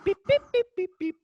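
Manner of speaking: high, quick electronic beeps; imitated app beeps. Presenter mimes setting the app's temperature, high imitated beeps. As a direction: beep like buttons being pressed.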